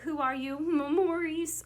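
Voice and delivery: scene line reading. Shown as a demonstration. Acting out both scene lines.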